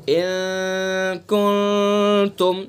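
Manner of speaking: इन कुंतुम slow and long, the n hidden in the nose; nasal ikhfa. Shown as a demonstration. Teacher showing the right nasal reading, slow recitation. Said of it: "इन कुन तुम ऐसे नहीं पढ़ेंगे हम".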